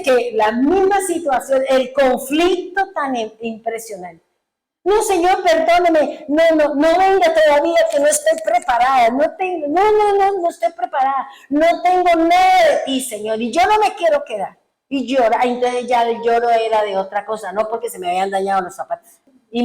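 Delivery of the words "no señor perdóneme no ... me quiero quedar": high-pitched re-enacted begging; frightened pleading. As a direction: plead with the Lord not to come yet. Re-enacts frightened plea to the Lord. (súplica asustada y aguda, recreada)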